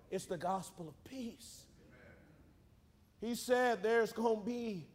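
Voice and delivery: normal talking voice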